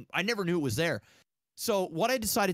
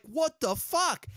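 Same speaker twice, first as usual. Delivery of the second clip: stunned disbelief; 12 semitones higher, slower than usual. Retold or imitated. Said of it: "I'm like".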